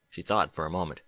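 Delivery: ordinary delivery, nothing marked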